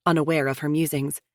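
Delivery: plain reading voice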